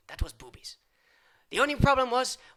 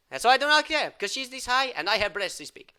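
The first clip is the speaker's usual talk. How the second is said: ordinary delivery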